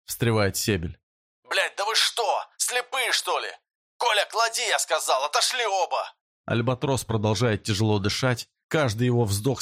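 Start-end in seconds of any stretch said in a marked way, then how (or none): 1.4-3.5 angry outburst. Acted. 4.0-6.1 high furious command; angry shouted order. Acted.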